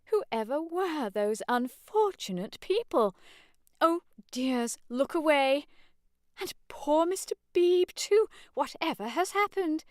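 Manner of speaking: high, fussy scandalised matron; shocked fussy old lady voice; flustered mother. Acted. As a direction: fluster over the scene.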